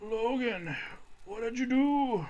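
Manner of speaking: put-on high, slow, frail old man. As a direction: creak it out like an aging professor. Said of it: "Are you doing, like, an old man voice?".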